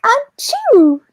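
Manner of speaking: dainty sneeze. Retold or imitated. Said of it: "people whose sneezes sound like".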